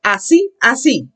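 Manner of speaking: "Así" with a high, stressed final "í"; accent the I. Shown as a demonstration. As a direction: lift and hit the "í" in "así". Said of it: "Accent the I".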